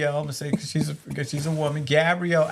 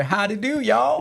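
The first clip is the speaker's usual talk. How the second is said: mock Texan howdy; 7 semitones higher than usual. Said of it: "you had might even say".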